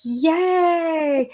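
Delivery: mock cheer, high and loud; a sarcastic little celebration; sarcastic yay. Real, not acted. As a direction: fake cheer over jury duty. Sarcastic yay about jury duty.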